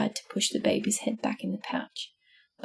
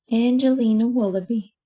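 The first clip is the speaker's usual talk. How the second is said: plain reading voice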